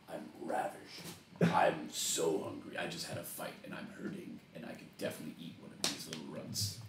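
low, soft, hissing fiend voice; low devilish Infernal. Acted. Milo speaking Infernal to Jinx, low hungry whisper. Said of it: "and then in Infernal".

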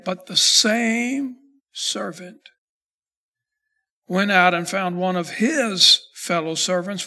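scripture reading. Acted. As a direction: read the parable steadily and solemnly. Reads verse twenty-eight.

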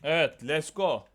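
triumphant. Real, not acted.

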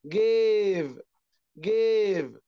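جيف with a long, held "ay". Shown as a demonstration. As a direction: draw out the vowel of each جيف. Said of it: "لونج إيه". (مدّ الإيه في «جيف»)

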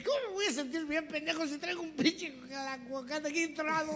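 high, strained complaint; squeaky comic indignation; irritated father. Acted. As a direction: whine back at the stupid question. Stuck man snaps at boyfriend.

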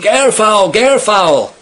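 high, loud repeated cry; screamed. A narrator's reading. Hunter pointing out the great auks to the men, screamed. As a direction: call it out high and urgent. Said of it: "he screamed".

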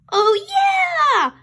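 high, loud delighted yell; excited shout. Acted. Boy cheering to sister about Easter candy. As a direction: burst out with glee.

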